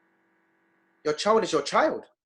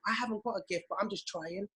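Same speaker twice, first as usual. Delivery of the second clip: soft humble voice; 4 semitones higher, 8 dB quieter than usual. Retold or imitated. Voicing the quiet trier.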